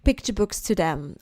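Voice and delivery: usual speaking voice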